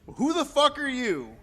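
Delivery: mock-aggressive challenge, voice jumping up; indignant retort. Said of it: "this is how he responds".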